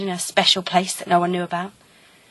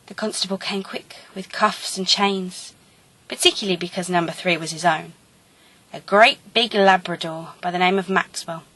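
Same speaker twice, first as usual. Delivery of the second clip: usual speaking voice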